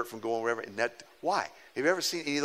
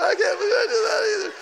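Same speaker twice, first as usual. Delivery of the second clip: very high, loud laughing cry; embarrassed squeal of disbelief; laughing shout; 4 dB louder than usual. Retold or imitated. David laughing at his own email mistake, very high and loud. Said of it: "He said".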